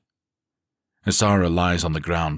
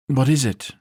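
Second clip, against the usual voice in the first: higher, louder woman's voice asking; curious woman; 9 semitones higher, 5 dB louder than usual. A narrator's reading. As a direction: ask eagerly as Zarina. Zarina asks.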